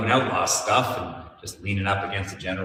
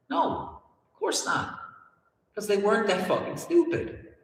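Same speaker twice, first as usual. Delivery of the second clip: mocking scoff; 10 semitones higher, 3 dB quieter than usual. Real, not acted. Mocking rhetorical answer.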